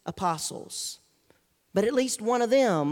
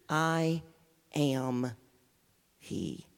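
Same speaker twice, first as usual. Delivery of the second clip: slow, each word set apart, fading lower and softer; powerful name; 8 semitones lower, 5 dB quieter, much slower than usual. Acted. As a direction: let each word land alone, sinking into a hush. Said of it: "with the powerful name".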